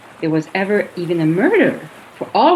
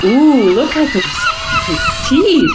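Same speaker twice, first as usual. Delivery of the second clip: excited; 8 semitones higher than usual. Acted. Sees shark teeth up close.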